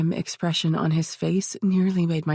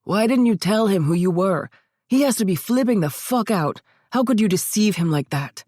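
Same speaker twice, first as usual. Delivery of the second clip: angry biker; 2 semitones higher, 4 dB louder than usual. A narrator's reading. Biker angrily confronts her.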